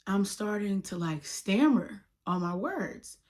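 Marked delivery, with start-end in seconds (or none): none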